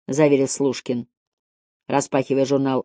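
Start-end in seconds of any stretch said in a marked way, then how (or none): none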